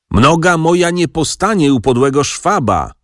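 a furious man yelling after his brother; angry shout. A narrator's reading. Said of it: "krzyknął Jan". (oburzony, wysoki okrzyk)

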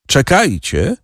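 narrator's restrained shout; mild cry-out. A narrator's reading. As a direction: call out to halt them, understated. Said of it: "krzyknął".

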